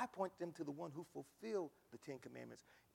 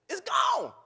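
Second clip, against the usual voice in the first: shout; 16 dB louder than usual. Real, not acted. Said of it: "shouted climax".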